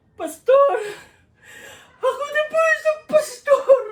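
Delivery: high, loud wailing cry; hammed-up crying wail; mock sobbing. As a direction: blubber it out like a grown man bawling. [name] weeps with crying pastor.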